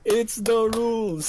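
slow, high singsong "It's the rules"; mock-stern repeat. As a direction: tease it out like a strict referee. Repeated, drawn out again.

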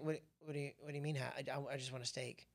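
soft, bewildered steak lover; baffled mumble. Retold or imitated. Husband quietly baffled by wife's side dish question, soft mumble. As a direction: mumble it like you can't believe the question. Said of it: "I'm like".

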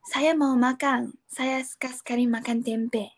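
slow, careful textbook Indonesian; formal learner Indonesian. Shown as a demonstration. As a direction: speak like a careful foreign learner.

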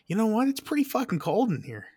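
high, puzzled inner voice; puzzled self quote. Retold or imitated. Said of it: "I'm like".

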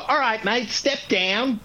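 high mock Aussie heckle; crowd of Aussies. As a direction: call up like a pushy crowd of mates. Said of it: "twenty-six million to go".

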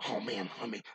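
ordinary talk, nothing marked

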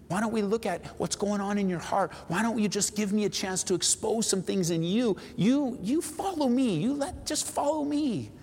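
high, lighter voice, patiently insistent; acted gentle urging, slowing on the last command; coaxing reply. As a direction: answer the complaint with patient, high-pitched appeal. Jesus voiced answering the complaint.

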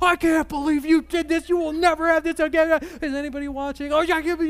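high, loud mock scolding; angry parent in the van. Parent scolds kids in the van, loud mock fury. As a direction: rip into the kids once the doors shut. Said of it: "You get to the van, you're like".

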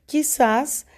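qui-ZÁS, final syllable stressed; stress on SAS. Shown as a demonstration. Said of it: "la sílaba más fuerte es el sas".

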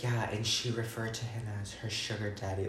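flat, quiet, matter-of-fact; deadpan. Retold or imitated. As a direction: say it like nothing odd. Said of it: "she said it so, like, normal".

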